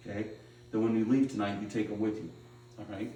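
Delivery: ordinary delivery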